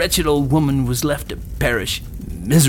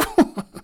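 put-on old crone's chuckle; witch's cackle. A narrator's reading. Witch has coaxed the children in, cackling.

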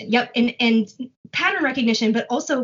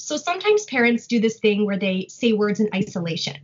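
usual speaking voice

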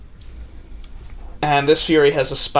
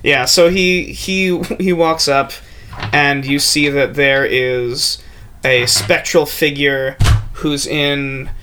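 ordinary delivery

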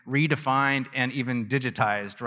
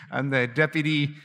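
lightly voiced boastful title; mock official. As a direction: toss off one more silly title. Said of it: "I could go on".

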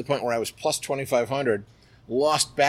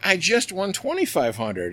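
defensive protest; 9 semitones higher than usual. Retold or imitated. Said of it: "And I'm like".

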